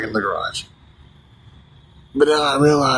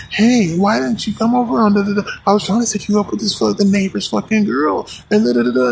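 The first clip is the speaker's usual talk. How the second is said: high, nagging mom voice; mock scolding mother; 8 semitones higher than usual. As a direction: play the pushy mom, pitched up. Mom scolding son in movie.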